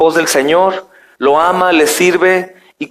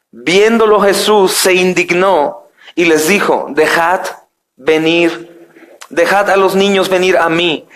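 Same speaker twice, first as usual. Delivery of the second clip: raised, measured reading of the verses; preacher reading Mark aloud to his church, solemn and raised; scripture reading; 3 semitones higher than usual. Acted. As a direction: proclaim the Gospel passage to the congregation. Reads the Gospel aloud.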